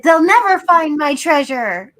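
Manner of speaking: loud mock bird proclamation; talking bird exclamation. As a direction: call it out loudly as a boastful bird. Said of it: "one flew up and said".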